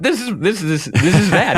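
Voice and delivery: high, rising burst of recognition; excited realization. Retold or imitated. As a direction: exclaim it as it clicks. Acting out delighted realization on hearing album.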